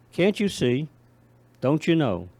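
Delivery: loud, earnest recital; poem reading. Acted. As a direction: plead each question out loud. Poem read aloud, louder.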